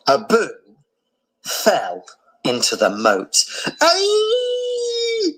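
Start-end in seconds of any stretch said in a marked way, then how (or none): 3.8-5.3 put-on very high piercing scream of a small child; little gorilla scream. A narrator's reading. Abu falling into the moat, screaming high. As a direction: shriek in sudden fright. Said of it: "screamed Abu".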